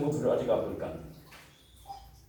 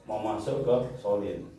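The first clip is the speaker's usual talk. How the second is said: ordinary delivery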